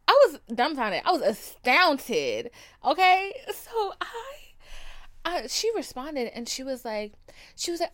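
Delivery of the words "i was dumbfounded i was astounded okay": loud, drawn-out stunned exclamation; shocked exclaiming. Real, not acted. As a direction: belt out your disbelief.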